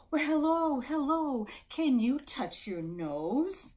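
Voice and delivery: high, loud singsong greeting, then slow; baby talk. Shown as a demonstration. Caregiver to baby in lap, playful baby talk. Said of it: "look at my baby in my lap".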